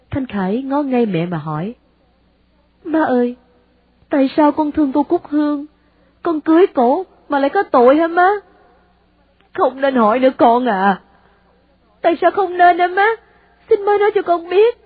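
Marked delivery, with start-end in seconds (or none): 2.8-14.8 put-on higher, rising desperate plea from a young son; pleading son. A narrator's reading. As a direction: beg your mother for an answer. Son begs mother for reason.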